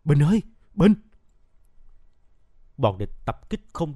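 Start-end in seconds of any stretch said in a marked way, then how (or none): 0.0-0.9 loud, high cry of a name; sudden cry. A narrator's reading. Huy sees Bình lying motionless, crying out. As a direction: call out sharply to a fallen friend. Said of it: "Huy bật kêu lên".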